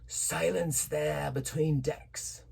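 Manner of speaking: slow, gruff pirate command; villainous oath. A narrator's reading. A hint of the captain snarling silence at the doctor. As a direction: snarl it slowly at the room. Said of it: "broke out with a villainous low oath".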